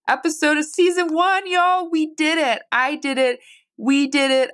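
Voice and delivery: excited, high-pitched cheer; triumphant celebration. Real, not acted. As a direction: celebrate a big win with everyone. Celebrates finishing the first season.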